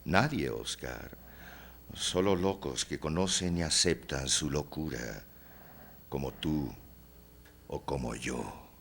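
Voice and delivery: low, soft, intimate voice; hushed confiding. Acted. Confides their shared madness. (confidencia en voz baja y grave)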